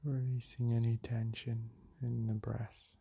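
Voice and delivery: calm, unhurried meditation voice with long pauses; soothing meditation guide, leaving space between phrases; guided meditation. Acted. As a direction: guide them gently back to the breath.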